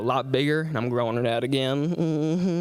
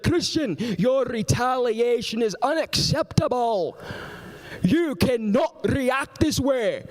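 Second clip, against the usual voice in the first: high, slow, over-enunciated reprimand; scolding camp director; 7 semitones higher, slower than usual. Retold or imitated. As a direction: scold word by word in a put-on pompous voice. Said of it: "the dude that was running the camp".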